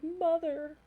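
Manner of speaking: high, loud voice for the dog. Retold or imitated. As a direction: speak as the dog, indignant. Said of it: "and he was like".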